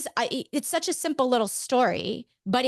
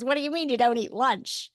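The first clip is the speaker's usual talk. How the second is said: higher, attempted strong New York accent; nanny New York accent; 4 semitones higher than usual. Friend questions her skipping lunch, in an imitated New York accent. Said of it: "like, a nanny accent".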